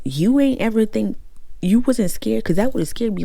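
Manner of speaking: loud, high-pitched disbelief; incredulous scolding. Real, not acted. Reader scolds Ebony for going to the apartment, loud and incredulous. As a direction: scold her as if she should have known better.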